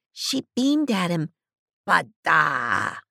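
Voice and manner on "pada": put-on much lower, accented exclamation; beaming auntie. A narrator's reading. Auntie Redmilla exclaims to the hippie. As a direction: beam it out like an old aunt.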